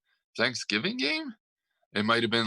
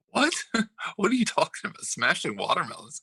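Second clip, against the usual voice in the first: high, laughing disbelief; real bewilderment; 9 semitones higher, faster than usual. Real, not acted. Co-host reacts to an odd watermelon story, bewildered and laughing. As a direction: blurt it out, baffled and amused. Baffled by co-host's watermelon remark.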